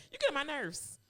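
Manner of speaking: usual speaking voice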